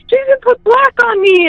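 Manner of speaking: high-pitched imitation of a hysterical little boy; retold hysteria. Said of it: "he was just hysterical".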